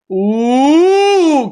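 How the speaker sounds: high, loud drawn-out ooh; excited ooh. Real, not acted. Streamer watching a shot, crying out. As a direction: gasp at the chance. Near goal chance.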